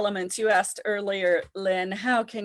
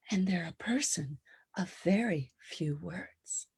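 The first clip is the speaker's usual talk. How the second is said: metal whisper; 3 semitones lower, 7 dB quieter than usual. Shown as a demonstration. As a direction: breathe it out sparingly, few words. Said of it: "the metal has more of a whisper".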